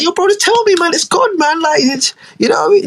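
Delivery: high, pleading squeak; mock pleading Isaac. Isaac begging Gabriel to spit it out, high pleading. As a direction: beg him high and pressing to just say it. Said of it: "Isaac, like".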